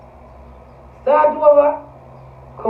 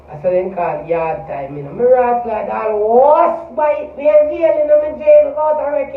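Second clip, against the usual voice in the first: ordinary talk, nothing marked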